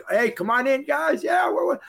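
high excited shout up the driveway; calling out. Retold or imitated. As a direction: holler them in from the bottom of the hill.

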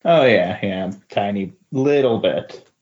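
usual speaking voice

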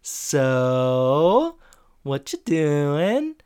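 slow teasing singsong; drawn out, knowing tease; knowing Eric. Retold or imitated. Eric teasing the couple about prom night, slow and sly. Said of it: "And Eric goes".

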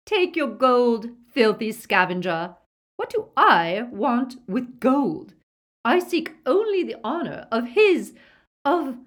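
spurn him haughtily, breaking off at the end; contemptuous Bellatrix. A narrator's reading.

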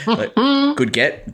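high, slow sarcastic "good get"; drawn-out mock praise. Real, not acted. Echoing a comment, mock drawn out.

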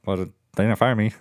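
usual speaking voice

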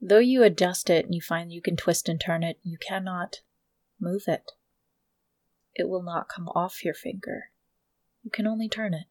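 low, soft scene setting; soft, low storyteller with pauses; game master narration. A narrator's reading.

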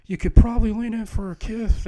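high, loud sketch-character voice; hammed-up best-friend role. Co-host acts the best friend giving kissing advice, high loud character voice.

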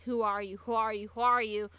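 loud, acted repeated demand; escalating shouted question; urgent questioning. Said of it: "Frykowski started asking".

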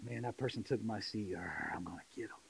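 low, very soft grudge-holding mutter; hushed mock menace; mock grumbling. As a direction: grumble under your breath, sinking to a near whisper. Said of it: "you know these people".

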